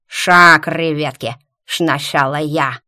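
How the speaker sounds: loud lisping yell; whistling s. A narrator's reading. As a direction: shove in, lisping every s. Lisping convict shoving in.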